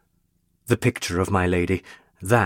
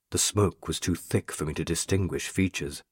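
ordinary delivery

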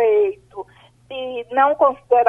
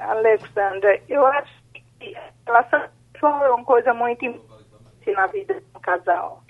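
ordinary delivery

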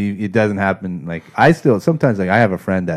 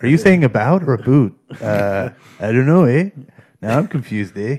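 Canadian tech support; 3 semitones higher, 4 dB louder than usual. Pretend. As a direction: play a confused Canadian helpline agent. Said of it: "that kind of conversation".

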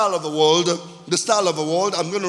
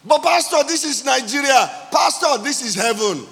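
raised, higher-pitched pulpit voice; impassioned preaching; 5 semitones higher, 4 dB louder than usual. Real, not acted. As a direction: drive each line home to the congregation.

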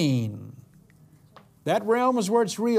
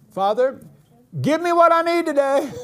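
much higher, louder give me; bold high plea; 9 semitones higher, 5 dB louder than usual. Acted. Preacher mimicking a bold prayer, high and loud. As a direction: belt it out to heaven.

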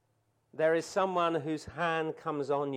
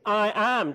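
both words hit hard and loud; emphatic I am; 3 semitones higher, 5 dB louder than usual. Shown as a demonstration. Preacher stressing God's name, emphatic. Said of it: "in the Greek it's emphatic".

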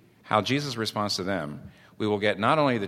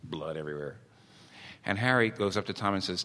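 ordinary talk, nothing marked

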